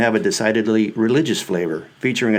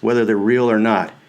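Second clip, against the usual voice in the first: plain reading voice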